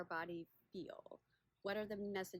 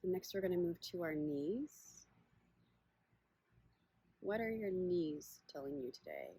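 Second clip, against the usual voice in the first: unhurried meditation guide, long pauses between phrases; guided body scan; 2 semitones lower, 4 dB louder than usual. Acted. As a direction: guide listeners gently inward, leaving space after each question.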